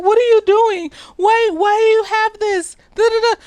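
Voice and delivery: high-pitched mock panicked questions; imitated alarm. Maddie questioning the man with a gun, imitated alarm. Voicing Maddie held at gunpoint.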